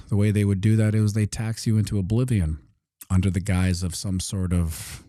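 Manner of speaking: ordinary talk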